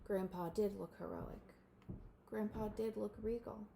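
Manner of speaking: ordinary delivery, nothing marked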